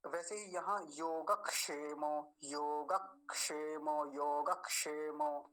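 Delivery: योगक्षेमो drawn out, क joined to योग; slow योगक्षेमो. Shown as a demonstration. Teacher to students, slow model pronunciation. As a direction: slowly say योगक्षेमो, योगक-षेमो. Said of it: "ऐसा उच्चारण इसका होगा".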